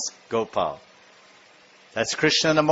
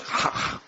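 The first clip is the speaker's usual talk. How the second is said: short voiceless monkey huffs; quiet breathy monkey pant; monkey noise; 8 dB quieter than usual. Acted. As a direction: puff like a monkey, no voice. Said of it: "So then the monkey goes".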